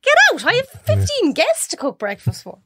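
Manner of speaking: put-on a harried mother shooing a kid away; mom shouting. Busy mom shouting at child.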